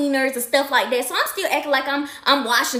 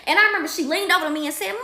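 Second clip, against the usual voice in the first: ordinary delivery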